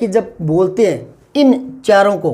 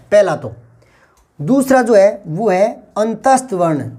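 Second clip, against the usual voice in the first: usual speaking voice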